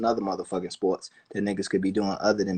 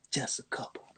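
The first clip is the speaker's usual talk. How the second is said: whisper; 3 semitones higher, 12 dB quieter than usual. Real, not acted. Aside about saving only a few.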